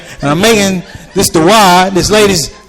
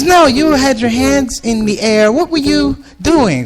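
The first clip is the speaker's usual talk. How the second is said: higher, slow prying lady voice; nosy lady; 7 semitones higher, slower than usual. Retold or imitated. Lady quizzing the comedian about his hands, high prying voice. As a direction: press him, slowly.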